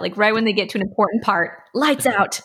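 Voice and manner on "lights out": evil game master. Pretend. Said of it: "You would do something evil like that".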